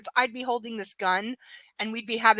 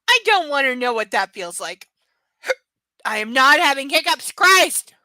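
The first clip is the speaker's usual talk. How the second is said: exasperated outburst; 2 semitones higher, 9 dB louder than usual. Real, not acted. Mock outrage at the book scene.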